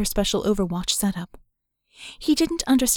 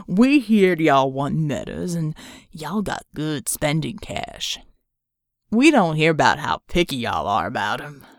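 slow, lowered Southern drawl; 5 semitones lower than usual. Acted. Reb leader sizing up the buyer, slow Southern drawl. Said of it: "Reb leader".

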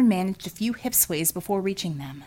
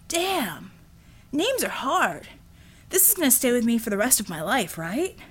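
Brad's fretting voice; 3 semitones higher than usual. A narrator's reading. Brad frets over his name.

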